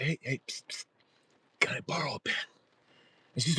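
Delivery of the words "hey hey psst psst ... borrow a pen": hushed, whispered re-enactment; furtive whisper. Retold or imitated. Asking old lady in the pew for a pen, furtive whisper. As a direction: whisper it sideways to a neighbour.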